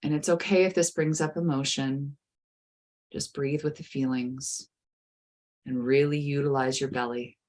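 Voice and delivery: soothing guided meditation. Acted. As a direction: ease the listener gently into calm.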